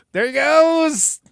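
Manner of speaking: high, loud, long-drawn holler; drawn-out, high-pitched cheer; playful send-off call. Pretend. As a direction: call it out big and stretch the last word. Host calls out as guest leaves.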